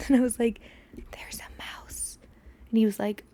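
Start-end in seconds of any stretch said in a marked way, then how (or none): 1.1-2.2 slow urgent whisper; hushed, slowed warning. Retold or imitated. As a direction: whisper the news slowly. Whispering to a stranger in hallway.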